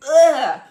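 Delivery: loud, revolted groan; big exasperated groan; disgusted ugh. Real, not acted. Disgust at self-proclaimed gurus.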